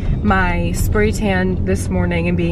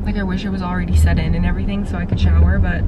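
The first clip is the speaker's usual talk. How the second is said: fast; much faster than usual. Real, not acted. Rushed complaint.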